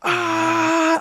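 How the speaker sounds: sudden high mock shriek; short sharp scare scream; imitated jump scare scream. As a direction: yell it like a ghost girl popping out. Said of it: "She goes".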